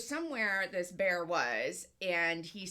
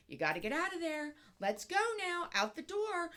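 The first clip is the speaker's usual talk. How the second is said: higher, sing-song coaxing like to a puppy; mock coaxing a pet; 7 semitones higher than usual. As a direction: coax the bear out like a pet dog. Guy coaxing bear like pet.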